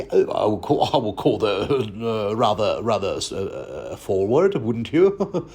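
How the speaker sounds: faltering Cockney innkeeper voice; Cockney accent. Acted. Innkeeper line, fading Cockney. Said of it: "I did a crap job with the accent".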